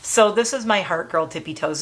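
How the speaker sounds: normal talking voice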